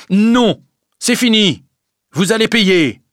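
loud, high, cutting demand; furious creditor; angry refusal. A narrator's reading. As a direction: shut them down hard.

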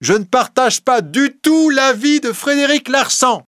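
put-on a young voice rising over the courtroom; young man speaking up boldly from the back. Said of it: "une voix jeune s'éleva qui dit".

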